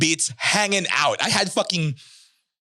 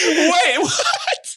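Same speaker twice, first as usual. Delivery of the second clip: high, loud disbelieving wait what; shocked; 4 dB louder than usual. Real, not acted. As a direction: gasp it out in disbelief. Shocked at Big Show reveal.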